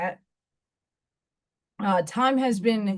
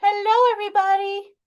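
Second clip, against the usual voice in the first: put-on high, loud, sing-song baby talk to the cat; excited cooing at the cat; high pet talk; 8 semitones higher, 5 dB louder, faster than usual. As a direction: coo at the kitty like she's a baby.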